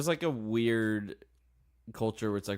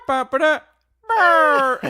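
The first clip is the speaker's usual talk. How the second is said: high loud mock bleeping; censor bleep noise; 14 dB louder than usual. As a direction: bleep the word out in a squeaky burst. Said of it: "You know who I really don't like".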